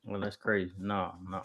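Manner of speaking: ordinary talk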